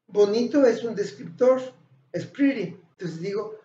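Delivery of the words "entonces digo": ordinary talk, nothing marked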